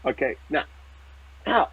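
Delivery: ordinary talk, nothing marked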